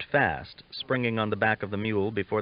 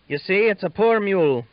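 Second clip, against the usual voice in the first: much higher and louder, put-out protest; indignant; 11 semitones higher, 8 dB louder than usual. A narrator's reading. Boy sprung up after falling, protesting indignantly to the laughing men. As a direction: defend yourself, offended and flustered. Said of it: "the boy said indignantly".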